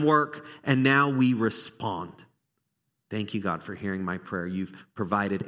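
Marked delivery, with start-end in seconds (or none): 3.1-5.4 quiet thankful prayer. Pretend. Voicing a prayer.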